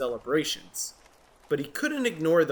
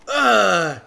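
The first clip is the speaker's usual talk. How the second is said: loud groan of disgust; disgusted ugh; 5 semitones higher, 9 dB louder than usual. Acted. Jim covering his ears, disgusted groan. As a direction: groan at the noise.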